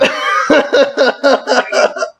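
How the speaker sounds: long, high-pitched burst of laughter; high helpless laugh. Real, not acted. Joker whose running joke came true, high helpless laughter. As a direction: crack up in a high cackle.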